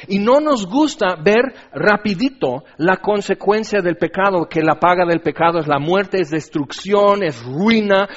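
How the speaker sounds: urgent, driving sermon delivery; forceful preaching. Real, not acted. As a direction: pound out each consequence emphatically.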